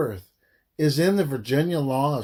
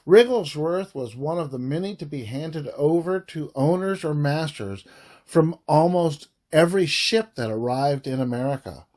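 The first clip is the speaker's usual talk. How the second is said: usual speaking voice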